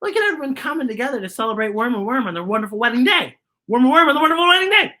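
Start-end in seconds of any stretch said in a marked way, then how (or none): 3.7-4.9 tongue twister, fast. Shown as a demonstration. Reader racing the tongue twister, fast and higher. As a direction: rattle it off fast and high. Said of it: "Say that five times fast".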